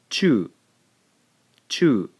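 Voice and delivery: "chu" as one loud syllable; one clean beat for "chu"; blended chu. Shown as a demonstration. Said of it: "we end up with".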